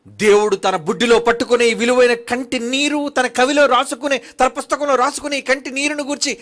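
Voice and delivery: loud, high pulpit exhortation; fiery preaching. Acted. Preacher urging listeners to weep only for God, preaching loudly. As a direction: drive it home like a revival preacher.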